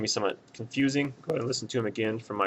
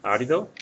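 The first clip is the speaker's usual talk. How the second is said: árido hit louder on the first syllable, Á-ri-do; third-from-last stress; 3 semitones higher, 5 dB louder than usual. Shown as a demonstration. Teacher showing an accent-mark answer, árido stressed on Á. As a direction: punch the Á that opens árido. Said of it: "stress is the third or more back".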